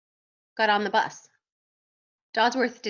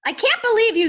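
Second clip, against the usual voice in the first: high, loud exasperated scolding; yelled; 13 semitones higher, 8 dB louder than usual. A narrator's reading. Dodsworth scolds the duck as they arrive, yelled. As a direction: yell it in disbelief. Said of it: "yelled Dodsworth".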